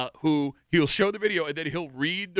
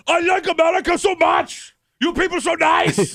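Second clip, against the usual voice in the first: put-on high, loud gushing German accent; hammed-up, thrilled German tourist accent; 11 semitones higher, 7 dB louder than usual. Host imitating Germans interviewed in America, gushing German accent. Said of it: "and they all sound like".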